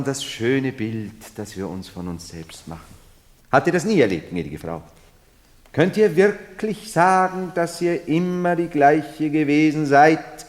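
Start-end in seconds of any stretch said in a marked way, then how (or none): 3.5-10.4 insistent challenge. Acted. Pressing the Marchioness with questions.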